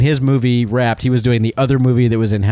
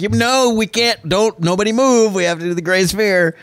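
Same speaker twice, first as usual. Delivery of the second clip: pitched way up, slowed, pleading; urgent plea; 10 semitones higher than usual. Retold or imitated. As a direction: halt the whole crew in a panic. Stopping the crew from breaking.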